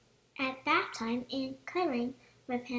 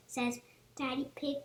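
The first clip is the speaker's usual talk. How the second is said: ordinary delivery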